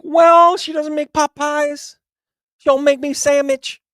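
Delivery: put-on high, loud childish whine; complaining Adam.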